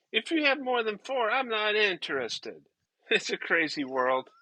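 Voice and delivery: ordinary talk, nothing marked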